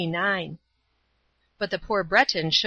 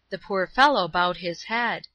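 plain reading voice

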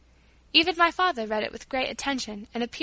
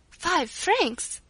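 higher, amazed repeating of the words; astonished; 6 semitones higher than usual. A narrator's reading. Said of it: "with astonishment now instead of tears".

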